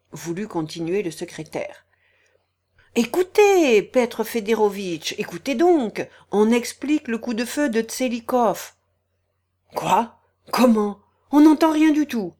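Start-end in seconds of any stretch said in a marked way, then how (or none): 3.0-8.7 calling over the noise. Acted. Shouting over the uproar. 9.8-12.3 loud complaint. Acted. Shouting complaint in uproar.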